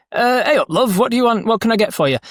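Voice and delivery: high-pitched shopkeeper in a put-on regional British accent; improvised shopkeeper accent. Game master improvises a shopkeeper greeting customers, in a high put-on accent. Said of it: "Rob's just put on some accent".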